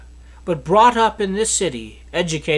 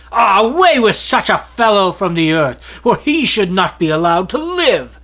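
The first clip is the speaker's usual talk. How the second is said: louder, raised crowd outcry, a touch rough; crowd shouting; 5 dB louder than usual. Acted. Crowd crying out against Paul, loud raised outcry. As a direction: call it out like an angry mob demanding his death. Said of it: "they raised their voices and said".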